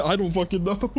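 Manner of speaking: breaking into real laughter at himself; laughing. Real, not acted. Cracking up after botching the accent, real laughter. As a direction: laugh it off, embarrassed.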